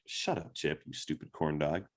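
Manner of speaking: under her breath. Retold or imitated. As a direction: grumble it to yourself after he's gone. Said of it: "talking under her breath".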